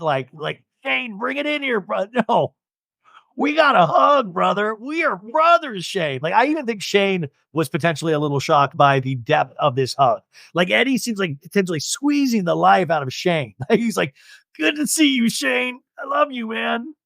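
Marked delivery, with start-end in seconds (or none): 0.8-6.2 high, hammed-up buddy call; high mock bro greeting, hammed-up. Eddie's bro hug imitated. 14.6-16.9 high, hammed-up buddy call; high mock bro greeting, hammed-up. Eddie's bro hug imitated.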